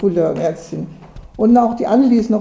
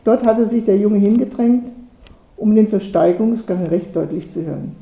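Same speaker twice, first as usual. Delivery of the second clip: ordinary delivery, nothing marked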